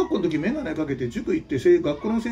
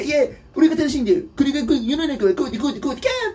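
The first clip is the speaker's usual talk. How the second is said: higher and louder, rattling fast, ending in a shriek; mocking panicky know-it-all; 8 semitones higher, 4 dB louder, faster than usual. Mocking a weak know-it-all who spouts theory, high frantic rattle ending in a squeal. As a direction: ham up a frantic weakling who argues, then squeals. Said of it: "って言ってんだよ".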